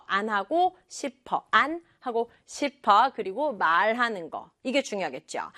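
normal talking voice

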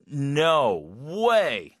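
long, forceful "no way" with big swoops; shocked. Shown as a demonstration. Teacher recapping four no ways, shocked. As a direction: stretch both words in shock.

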